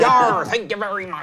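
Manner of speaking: silly pirate thanks; cartoon pirate gratitude; Muppet pirate voice. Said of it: "Sound like a Muppet when you do a pirate voice".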